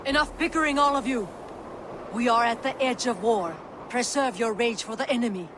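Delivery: high, urgent call to order; commanding rebuke. Acted. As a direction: cut through the quarrel with authority. Breaking up warriors' quarrel before battle.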